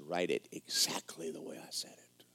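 hushed unvoiced whisper, low; whispered voice of the Lord. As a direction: whisper it like a voice in the night. The Lord speaks at night.

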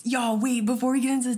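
fast excited announcement. Real, not acted. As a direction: blurt it out eagerly. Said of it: "excited interruption".

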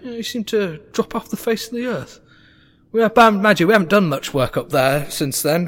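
lightly voiced, rambling halfling father; a touch of awkward catching up; chatty halfling rogue. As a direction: chuckle and ramble about old shady jobs.

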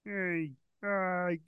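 Ray played by Kermit; Kermit-like Ray Romano. Trying Ray Romano but sounding like Kermit, soft. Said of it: "you're going very Kermit".

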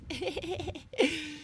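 soft giggle; breathy laugh. Real, not acted. Laugh about exercise.

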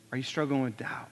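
soft hushed question; whisper. Real, not acted. Preacher asking the congregation about doubt, whispered. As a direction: lean in and whisper it to them.

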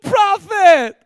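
high, loud, overeager mock cheer; hammed-up cheerful greeting. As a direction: hail the prophet like a long-lost buddy, shouting high. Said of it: "Saul greeted him cheerfully".

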